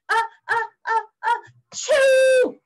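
high ah-ah build to loud burst; hammed-up explosive achoo; acted sneeze. Tickled bear sneezes in the mitten, loud acted achoo. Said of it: "The bear was about to sneeze".

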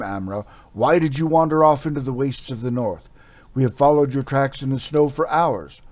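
urgent warrior. Acted. Warrior scolds Amra urgently.

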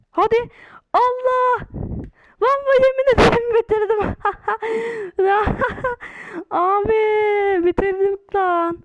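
high, loud, near-crying shout of joy; overwhelmed real victory yell; ecstatic scream. Real, not acted. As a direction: scream in disbelief, voice cracking with joy. Just finished the round, screaming.

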